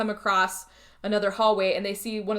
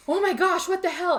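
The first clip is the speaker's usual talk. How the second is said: high, startled outburst; shocked cry; 10 semitones higher than usual. Retold or imitated. Friends reacting to Alan shoving the creature, a high shocked cry. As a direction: blurt it out in sudden alarm.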